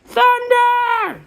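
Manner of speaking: high, loud frightened cry of thunder; scared shout. Acted. Little brother shouting in fear at the storm noise. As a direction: yell it out like a panicked kid. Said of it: "shouted Tim".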